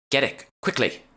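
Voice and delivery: high, pressing order; urgent command. A narrator's reading. Emma urging Jade to grab the alkahest fast. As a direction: hurry her along.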